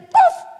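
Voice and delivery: sharp high slap noise; slap sound. Retold or imitated. Preacher acts out his mother's slap, sharp slap noise. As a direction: snap out a sudden slap sound.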